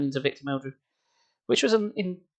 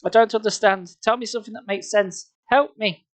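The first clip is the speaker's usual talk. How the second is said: high desperate pleading; 7 semitones higher than usual. Acted. Desperate plea for help.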